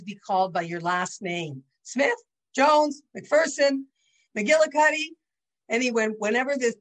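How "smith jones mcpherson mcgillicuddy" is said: high, loud, slow roll call of names; lightly mimicking a teacher calling on pupils. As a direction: sing out surnames like a teacher.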